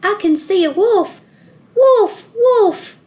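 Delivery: high, loud cry rising to a yell on wolf; calling out. A narrator's reading. Joseph tricks the village men, shouting wolf. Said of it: "he called".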